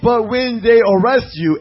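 loud, slow preaching declamation; scripture reading. Acted. Preacher reads the congregation a warning of arrest, declaimed scripture reading. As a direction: proclaim the verse, leaning on key words.

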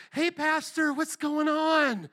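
high, sing-out hello with a stretched end; cheery greeting. Retold or imitated. Church lady surprising her pastor at the airport, cheery greeting.

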